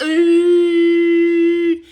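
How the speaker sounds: loud high mock sob; hammed-up wail. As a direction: wail like you just got terrible news. Starts crying on hearing news.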